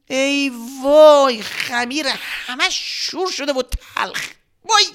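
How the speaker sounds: put-on high, dragged-out child's wail of dismay; a little girl realising her mistake. Little Reyhaneh discovering the salty dough, high and dismayed. Reyhaneh finds salt instead of sugar.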